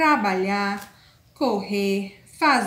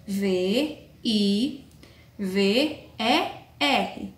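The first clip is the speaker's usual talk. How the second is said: spelling slowly; 3 semitones lower, much slower than usual. Shown as a demonstration. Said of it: "Viver se escreve".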